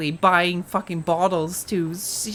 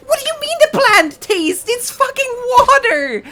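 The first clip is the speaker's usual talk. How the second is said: high, loud indignant outburst; furious squeal; outraged rant; 7 dB louder than usual. Real, not acted. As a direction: explode in disbelief at the ad. Ranting at the ad.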